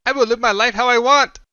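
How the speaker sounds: furious yell. A narrator's reading. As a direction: scream at his father in hate. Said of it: "yells the young man".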